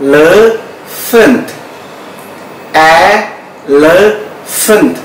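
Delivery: Elephant drawn out slowly, syllable by syllable; slow model pronunciation. Shown as a demonstration. Teacher drills Elephant slowly for students. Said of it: "pronunciation dalam bahasa Inggris adalah".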